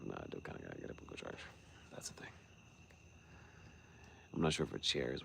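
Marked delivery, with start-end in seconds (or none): 0.0-2.3 fast, whispered to self. Real, not acted. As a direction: mutter it under your breath in a rush.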